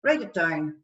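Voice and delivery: ordinary delivery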